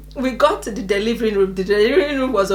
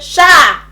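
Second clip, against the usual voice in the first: loud, high falling "sha"; explosive sound of something bursting free; imitated whoosh; 5 semitones higher, 7 dB louder than usual. Imitates baby shooting out.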